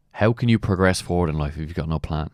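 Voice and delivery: ordinary talk, nothing marked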